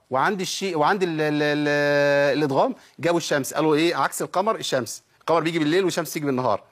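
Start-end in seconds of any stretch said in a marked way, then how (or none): none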